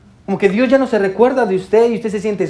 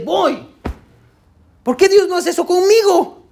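envious churchgoer; 7 semitones higher than usual. Retold or imitated. A listener envying others' miracles.

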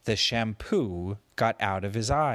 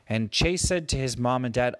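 ordinary delivery

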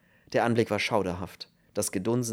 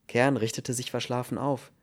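plain reading voice

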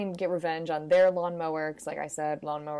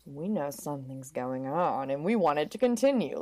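hushed, in on the secret; conspiratorial approval; 5 semitones lower than usual. Acted. Garden ornaments secretly back the lovers, low conspiratorial voice. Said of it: "or they're like".

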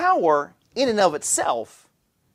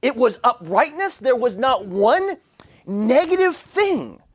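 building sermon; 5 semitones higher than usual. Acted. Pastor drives home the point on virtue, preaching with rising fervor.

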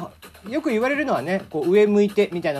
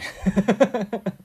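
brief falling chuckle; self-amused laugh; 3 semitones higher than usual. Real, not acted. Host admitting the nosebleed lifted his mood, self-amused chuckle. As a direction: laugh at your own odd reaction. Laughs at being cheered by blood.

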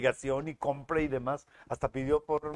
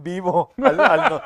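louder, words broken by laughter; laughing through words; 4 semitones higher, 12 dB louder, slower than usual. Real, not acted. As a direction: talk through the giggles. Bromea sobre el dulce. (hablando entre risas)

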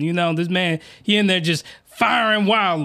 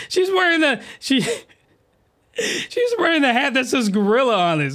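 laughing; 5 semitones higher than usual. Real, not acted. Laughing at the hat's name.

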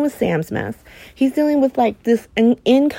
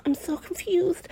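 high, soft, put-on confused voice; mock confused Sam; 9 semitones higher, 9 dB quieter than usual. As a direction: play her as dazed and lost, soft and up high. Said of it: "She's like".

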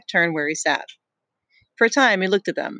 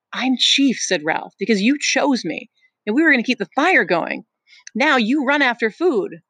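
put-on a boy's higher protesting voice; higher, urgent boy insisting; higher, pleading Ralph; 6 semitones higher than usual. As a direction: push back, voice up high.

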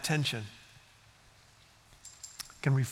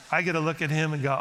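ordinary talk, nothing marked

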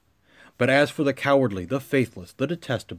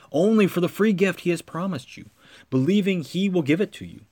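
normal talking voice

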